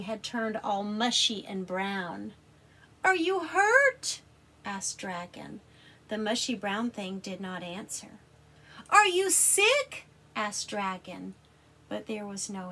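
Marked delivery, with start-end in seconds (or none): 3.0-4.2 high, loud, slow worried question. Acted. Dragon worried. 8.9-10.1 high, loud, slow worried question. Acted. Dragon worried.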